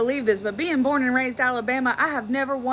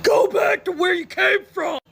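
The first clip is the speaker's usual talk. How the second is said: full-body shout; 8 semitones higher, 6 dB louder than usual. Pretend. Alabama local yelling at a South African, full-body shout. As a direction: throw your whole body into the yell. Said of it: "I'm gonna put my whole body into it".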